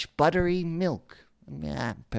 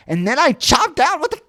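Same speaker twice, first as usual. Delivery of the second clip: boastful; 9 semitones higher, 11 dB louder, faster than usual. Acted. Villain boasting of chopping trees.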